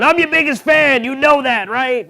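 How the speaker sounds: high, loud, eager father; proud dad. Retold or imitated. Dad telling his son he's his biggest fan, high and loud. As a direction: gush like an overexcited dad. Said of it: "my dad's always going".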